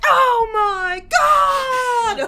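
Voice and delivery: long, drawn-out high shout of delight; excited shout. Retold or imitated. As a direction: scream it out, high, loud and slow. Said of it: "To being like".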